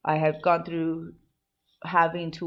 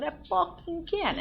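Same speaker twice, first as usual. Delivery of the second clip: high-pitched frustrated burst; strained high note of helplessness; 12 semitones higher than usual. Retold or imitated. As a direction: push it up high in exasperation. Venting how she cannot change.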